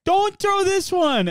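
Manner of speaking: put-on high, slow pleading kid; whiny kid voice. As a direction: beg like a little kid. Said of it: "he's like".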